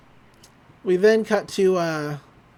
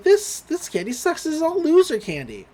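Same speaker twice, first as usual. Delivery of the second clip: put-on much higher, a bratty girl griping; whiny Mabel voice; 10 semitones higher than usual. Mabel griping to the family about cheap candy, high whiny voice. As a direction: pitch way up as the disgusted little sister.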